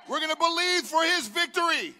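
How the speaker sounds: loud, high rally shouting; roaring rallying speech. Real, not acted. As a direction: rouse the crowd, full force.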